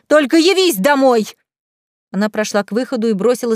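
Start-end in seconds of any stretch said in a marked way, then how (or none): 0.1-1.3 high, loud angry warning; shrieked threat. A narrator's reading. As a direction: threaten a husband at a raised pitch. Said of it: "взвизгнула Лена". (громкая визгливая угроза)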